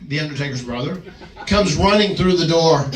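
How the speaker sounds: ordinary talk, nothing marked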